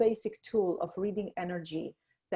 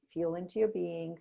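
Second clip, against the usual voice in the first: quiet guiding voice, fading softer; guided meditation; 7 dB quieter than usual. Shown as a demonstration. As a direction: draw the listener inward gently. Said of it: "feel into your body".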